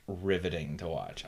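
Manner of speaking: ordinary talk, nothing marked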